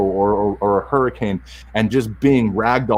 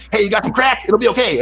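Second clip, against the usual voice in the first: much higher-pitched manic stranger voice; strung-out crackhead; 11 semitones higher than usual. Acted. Imagined crackhead drops in to reassure him, strung-out voice. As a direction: burst in as a strung-out addict. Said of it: "strung out crackhead coming".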